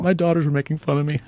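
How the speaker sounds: high, quick mock-weepy quote; mock emotional. Quoting his friend about his daughters, mock emotional.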